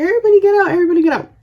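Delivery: high, repeated mock call; re-enacted panicky holler; imitated urgent alarm. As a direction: play an aunt rousing the whole house. Aunt alerting every room, retold.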